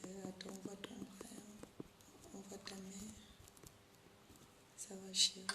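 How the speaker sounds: whispered aside to someone nearby; off-mic whisper. Real, not acted. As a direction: drop to a whisper away from the mic. Aside off-mic during stream test.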